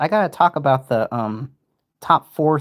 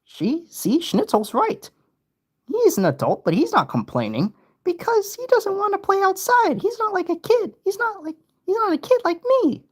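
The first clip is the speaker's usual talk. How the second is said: put-on squeaky high little kid whining. Kid character complaining to the group in a high voice. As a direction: pitch way up into a small child.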